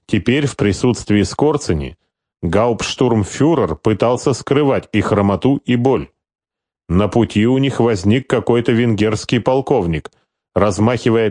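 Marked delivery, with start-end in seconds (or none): none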